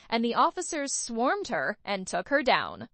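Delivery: ordinary delivery, nothing marked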